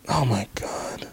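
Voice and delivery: breathy exasperated whisper, drawn out. Real, not acted. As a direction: whisper it in despair at the screen. Frustrated whisper at website.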